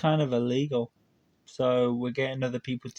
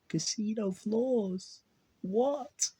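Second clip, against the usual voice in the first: 1 stunned disbelief; 7 semitones higher, 3 dB quieter, much slower than usual. Real, not acted. Stunned at news clip.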